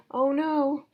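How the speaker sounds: put-on a child watching the broom get stuck, dismayed. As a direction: gasp out a childlike oh no.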